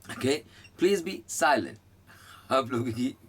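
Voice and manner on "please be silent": wife's command. Retold or imitated. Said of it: "जो हमारी बेगम अक्सर बोलती हैं".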